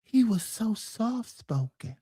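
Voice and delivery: slow, hushed, breathy gentle voice; soft-spoken Michael. Retold or imitated. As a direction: speak softly like Michael. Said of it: "We all love Michael".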